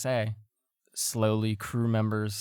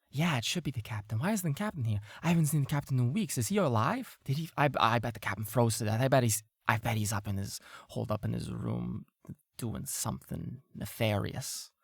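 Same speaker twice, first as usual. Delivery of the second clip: fast grumbling crowd; 3 dB quieter, faster than usual. Acted. Crew murmur complaints.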